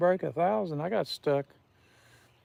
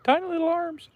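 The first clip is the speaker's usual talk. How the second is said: jumps way higher for the tiny arms; squeaky dino joke; 14 semitones higher, 4 dB louder than usual. Pretend. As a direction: squeak it out to mock the little arms. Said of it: "I'm the old dino".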